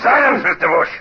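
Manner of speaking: loud, high bark; angry command. Acted. Captain silences the lieutenant, furious and loud. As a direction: slam the order down.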